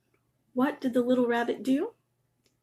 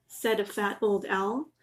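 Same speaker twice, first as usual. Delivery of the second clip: plain reading voice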